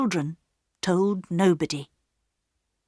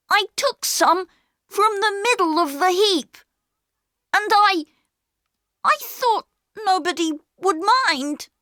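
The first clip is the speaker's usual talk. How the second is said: much higher, earnest explaining; pleading boy; 12 semitones higher, 3 dB louder than usual. A narrator's reading. Peter explaining to the station master, pleading. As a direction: plead his case.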